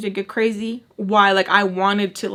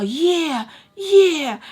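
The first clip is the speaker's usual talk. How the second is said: high, rising loud yeahs; gloating; 8 semitones higher, 5 dB louder than usual. Retold or imitated. Tommy gloating after hitting Charlie, high loud yeahs. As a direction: crow over what she just did.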